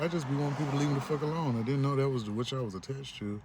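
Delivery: ordinary delivery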